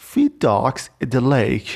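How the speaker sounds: extremely slow, feed stressed, falling; slow read-aloud example. Shown as a demonstration. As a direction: drag out each word for learners. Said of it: "توی مثالش گفته چی".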